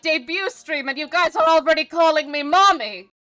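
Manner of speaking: high, loud scandalised squeal; high-pitched outraged exasperation at chat; flustered indignant. Real, not acted. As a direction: scold chat in shocked disbelief. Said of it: "that's still me being smug".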